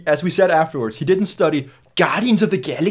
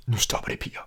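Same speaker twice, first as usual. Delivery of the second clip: soft, whispered stern warning in Danish; stern Danish hush; 4 semitones lower, 9 dB quieter than usual. Retold or imitated. Moviegoer shushes rude girls, whispered stern Danish. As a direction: hush them like a strict teacher. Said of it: "in Danish, I was like".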